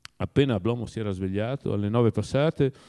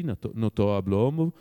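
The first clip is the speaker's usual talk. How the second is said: plain reading voice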